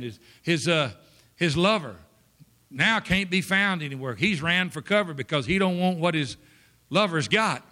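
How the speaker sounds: usual speaking voice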